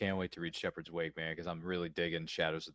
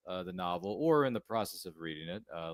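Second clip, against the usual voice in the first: normal talking voice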